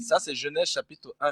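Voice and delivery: usual speaking voice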